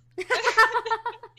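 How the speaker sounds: loud high laugh breaking out; real laughing fit; burst of laughter. Real, not acted. Laughs at the retold call.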